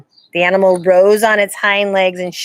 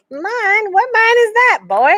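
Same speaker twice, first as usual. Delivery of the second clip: feigned surprise; 5 dB louder, slower than usual. A narrator's reading. Stranger feigns ignorance.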